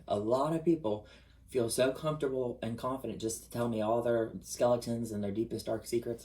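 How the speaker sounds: ordinary talk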